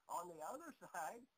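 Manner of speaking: ordinary talk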